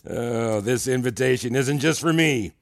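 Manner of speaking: normal talking voice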